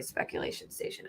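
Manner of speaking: breathy whispered quip; whispered aside. Real, not acted.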